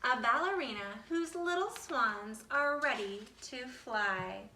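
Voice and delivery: ordinary delivery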